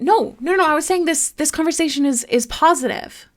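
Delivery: emphatic reassurance. Real, not acted. Insisting the talk is positive.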